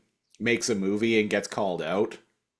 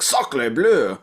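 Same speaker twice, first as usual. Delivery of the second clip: loud, higher mock French cry; French exclamation; 3 semitones higher, 6 dB louder than usual. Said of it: "and is like".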